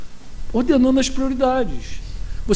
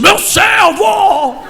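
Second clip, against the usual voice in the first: loud, drawn-out prophetic bellow, pitched up; hammed-up shout, stretching each word grandly; mock thick God voice; 9 semitones higher, 6 dB louder, much slower than usual. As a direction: imitate a put-on voice of God, very slow and loud. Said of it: "têm que falar mais grosso".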